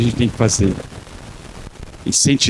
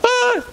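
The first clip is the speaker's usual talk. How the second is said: mock high sigh. Friend answering message again.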